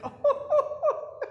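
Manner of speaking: high, laughing wonder at the tree; delighted laugh. Acted. Coyote marvels at the perfect tree, laughing. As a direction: gasp with delight and laugh through it.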